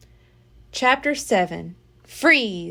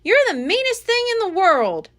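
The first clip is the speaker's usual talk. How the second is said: angry; 9 semitones higher, 3 dB louder than usual. Acted. Annie yelling at monkey.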